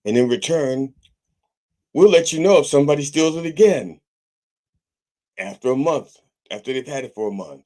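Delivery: lightly voiced corporate spokesman, mocking; mocking corporate letter. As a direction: sarcastically parrot the company's hollow apology. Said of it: "Just got a letter in the mail saying".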